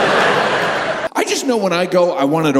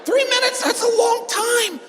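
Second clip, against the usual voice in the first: high squeal. Acted. Outraged repeat.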